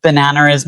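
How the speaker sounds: an R linking "Banana" to "is"; intrusive R. Shown as a demonstration. Poet giving an accent example, intrusive R after "Banana". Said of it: "put an R where there isn't one between two vowels".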